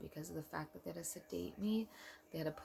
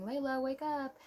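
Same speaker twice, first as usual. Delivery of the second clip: lightly acted, high singsong wake-up call; 9 semitones higher than usual. Nurse rousing a sedated mother, high wake-up call. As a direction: call out high to rouse a sleeper. Hospital staff calling to wake her.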